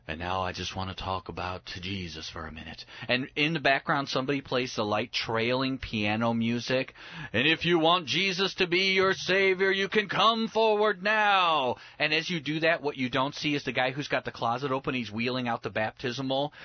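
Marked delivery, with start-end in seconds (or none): 0.1-2.8 low, soft, solemn preacher voice; pastor's altar call. Acted. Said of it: "the pastor gets up and he goes". 7.3-11.8 high, swelling preacher's invitation; mock altar call, stretched out at the end; pastor's altar call. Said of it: "the pastor gets up and he goes".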